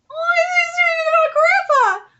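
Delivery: high, loud squeal of delight, slowed; gushing squeal. Real, not acted. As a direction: squeal over how cute it is. Fan gushing over cute panel.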